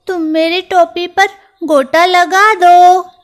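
high, loud mouse request; a tiny mouse insisting on trim; eager little mouse. Acted.

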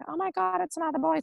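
high-pitched gasp of discovery; retold amazement. Mother seeing her newborn is another boy, amazed.

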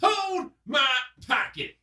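high-pitched quoted catchphrase; stand-up bit imitation. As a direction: mimic a comedian's prison-bit line. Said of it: "did you see the one, the stand-up".

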